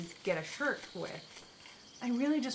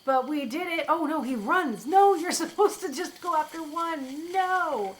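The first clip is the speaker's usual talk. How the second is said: higher and louder, yelling at the fleeing boar; loud frustrated cries; excited shouting; 7 semitones higher, 9 dB louder than usual. Real, not acted. Streamer yelling as the boar runs away, loud and high. Boar escapes mid-fight.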